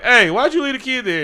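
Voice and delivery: mock indignant challenge; acted confrontation. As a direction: call him out, loud and high.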